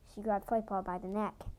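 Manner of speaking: ordinary delivery, nothing marked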